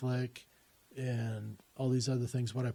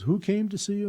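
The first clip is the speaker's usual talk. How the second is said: eager question; 8 semitones higher, 5 dB louder than usual. Retold or imitated. Woman urgently asks on phone.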